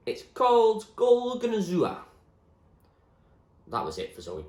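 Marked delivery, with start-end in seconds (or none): 0.1-2.0 high, loud, slow reveal; proud William. Acted. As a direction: announce the name grandly. William names the city.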